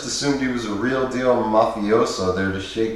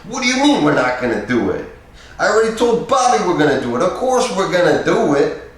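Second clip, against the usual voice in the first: high, loud, fast tough-guy rant; volatile outburst; 9 semitones higher, 5 dB louder than usual. A narrator's reading. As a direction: erupt with impatience. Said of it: "the volatile, impatient guy returning".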